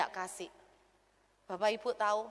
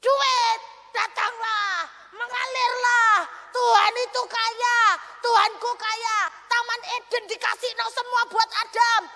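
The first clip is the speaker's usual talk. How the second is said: shouted, high money command; mimicking her frantic prayer for money, shouting; 11 semitones higher, 9 dB louder than usual. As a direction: call the money to come, hammed-up. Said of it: "Saudara lihat gaya doa nih".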